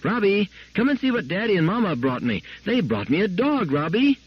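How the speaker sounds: put-on high, excited child calling downstairs. A narrator's reading. Little girl calling down the basement steps, excited. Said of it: "shouting as she went".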